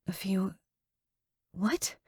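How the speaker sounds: panicked, rising disbelief; a few what, voice going up in panic; pitchy with panic. Acted. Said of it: "My voice gets pitchy with panic".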